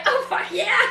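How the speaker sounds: high-pitched retold exclamation; mock-emphatic, amused outburst; amused agreement. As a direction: exclaim it as a recalled reaction, about to laugh. Amused retold reaction, laughing around it.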